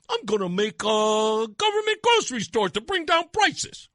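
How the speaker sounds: dopey put-on voice making a promise; mock stupid promise. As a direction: parody a foolish campaign pledge. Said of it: "telling people stupid things like".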